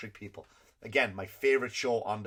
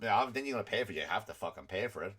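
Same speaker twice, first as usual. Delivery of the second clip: ordinary talk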